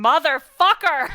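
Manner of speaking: loud, high, drawn-out yell; hammed-up furious shout; cursing up a storm. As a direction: bellow a mock curse as if nobody can hear. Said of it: "silencing spell and is going".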